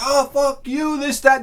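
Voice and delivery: high-pitched imitation of a quarreller; mock angry retort. Acted retort in beach quarrel.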